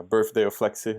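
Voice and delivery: ordinary talk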